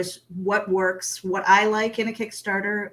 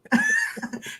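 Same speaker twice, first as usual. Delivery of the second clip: whispered laugh. Real, not acted. Laugh after whispered disbelief.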